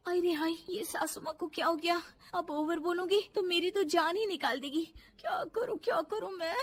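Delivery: flustered and helpless; panicked fretting. Acted. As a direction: fret to yourself, rising to a near whimper. Bahu panics at frozen mother-in-law.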